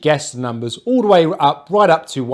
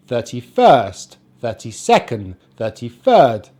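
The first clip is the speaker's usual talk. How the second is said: slow "Thirty-first" with FIRST drawn out; weight the second word, as in "thirty-third"; stress on the ordinal; 2 semitones lower, slower than usual. Shown as a demonstration. Teacher continuing the pattern for learners, ordinal stressed as in "thirty-second". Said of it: "following that same pattern".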